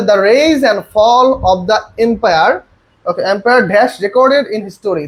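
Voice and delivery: every word drawn out for the class to copy; slow, deliberate question read-out; very slow dictation. Shown as a demonstration. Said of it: "বলছে".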